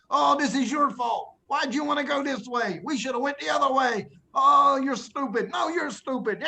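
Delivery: high, whiny bickering voices; hammed-up quarrel in a high voice; mock arguing. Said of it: "started to argue".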